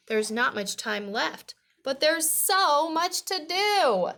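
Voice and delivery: put-on lively, projected read-aloud for children; storybook reading. A narrator's reading. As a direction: read it out clearly to young listeners.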